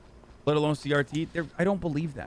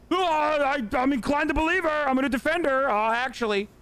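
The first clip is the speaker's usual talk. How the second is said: much higher, mock nerdy defender voice; dumb viewer voice; 11 semitones higher than usual. Streamer mocking chat's reaction, in a high smug nerd voice. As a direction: sneer it out like a smug gullible fan.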